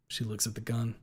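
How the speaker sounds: ordinary delivery, nothing marked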